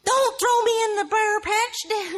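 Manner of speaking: very high, loud, slow cartoon squeal; hammed-up mock cartoon dialect plea; Brer Rabbit. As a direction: wail it high like a cartoon critter. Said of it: "that Disney Brer Rabbit shit".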